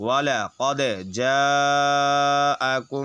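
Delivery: slow chanted tajweed reading; slow recited verse; Quran recitation. Shown as a demonstration. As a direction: draw out جاءكم slowly like in prayer. Demo recitation after the rule.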